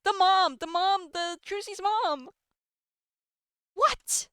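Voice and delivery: high, incredulous outburst; amazed disbelief. Real, not acted. Player blurting out that it's Trucy's mom, incredulous.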